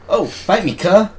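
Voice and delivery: loud, high-pitched put-on challenge; mock street slang. Showing how the slang goes, loud mock challenge. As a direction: throw out a cocky mock challenge. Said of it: "it's like".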